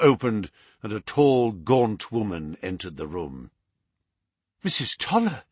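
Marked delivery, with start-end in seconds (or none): 4.6-5.4 high female exclamation; mildly surprised, pitched high; surprised cry. A narrator's reading. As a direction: exclaim her name in a raised voice. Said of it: "cried Miss Hunter".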